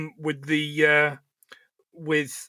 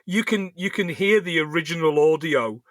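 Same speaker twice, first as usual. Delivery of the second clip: ordinary delivery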